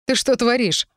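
startled turning angry; flash of anger. A narrator's reading. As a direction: demand an answer, suddenly cross. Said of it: "он в момент разозлился".

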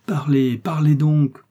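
loud, urgent plea; crying out. A narrator's reading. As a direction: urge her out loud to talk. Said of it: "s'écria le jeune officier".

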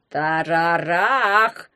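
slow drawn-out shout. A narrator's reading. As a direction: call the name out loud and long. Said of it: "крикнул академик". (громкий протяжный крик)

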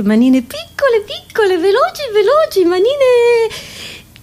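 high, pained little wail, building; squeaky, overwrought pity; mock-pitying squeal. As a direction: climb into a tiny high voice. Mock-pitying tiny hands of children.

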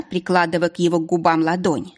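plain reading voice

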